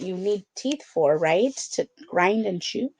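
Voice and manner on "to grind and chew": ordinary delivery, nothing marked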